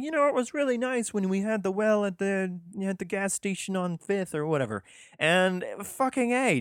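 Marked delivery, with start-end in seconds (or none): 0.0-4.8 much higher, fast whiny townsperson voice; nostalgic townsfolk. Retold or imitated. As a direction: play a fussy local reminiscing. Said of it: "people going like".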